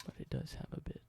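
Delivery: soft, close whispered ASMR roleplay. Acted. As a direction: whisper it gently like showing an old relic. Whispered ASMR show-and-tell roleplay.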